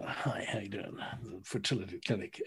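breathy mock whisper of a receptionist. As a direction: whisper it like a secret phone call. Mocking the clinic receptionist's phone greeting.

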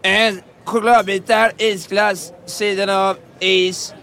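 ropar beställning. Acted. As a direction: call each item out separately, drawn out. Ropar ut beställningen till köket. (långsamt utropad beställning)

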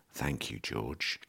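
soft, slow, humble thank-you; quiet, drawn-out deferential thanks; mock grateful thanks. Shown as a demonstration. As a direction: murmur it like a grateful seller. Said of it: "like that, 'cause that's what you have to say".

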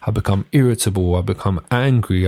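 ordinary talk

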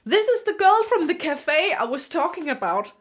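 high, loud mimicked introduction in English; imitated French dad. French dad introducing her to his partner, high mimicked voice. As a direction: mimic him presenting you eagerly. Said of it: "så siger han".

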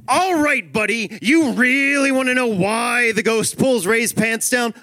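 high, loud, drawn-out mock rage; dad at breaking point. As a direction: yell it like you've finally snapped. Replays the dad's outburst.

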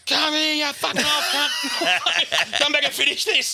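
very high, drawn-out mock yell; blowing up. Announcer yells after the fleeing touch judge, blowing up. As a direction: call after him in hammed-up fury. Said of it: "Blowing up".

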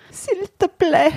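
high, slow begging; pleading. Acted. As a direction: draw out the plea, high. Acted plea in scene.

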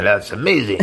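put-on light French accent; a touch of a French gourmet. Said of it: "little French accent there".

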